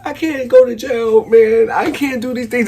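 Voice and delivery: high, loud mock panic; panicked good kid. Good kid thinking about going to jail, acted-out panic. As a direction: whine it like a kid dreading jail. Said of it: "This is what I'm thinking".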